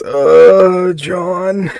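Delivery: lightly imitated drunk drawing out his words; sleepy drunk, voice lifted a little; drunk man. Drunk man giving his name to an officer, mock drunk. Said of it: "He's really, really drunk".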